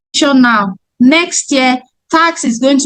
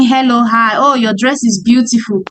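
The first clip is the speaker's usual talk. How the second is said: lower, light sales pitch to a churchgoer; friendly greeting; 4 semitones lower, faster than usual. Shown as a demonstration. Saleswoman greeting a churchgoer after service, lower and friendly. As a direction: chat up a stranger, lower and quicker at the end. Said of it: "When I'm greeting".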